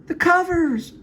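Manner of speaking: very high falsetto woman voice; Jane's voice. Acted. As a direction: squeak it out high and sweet.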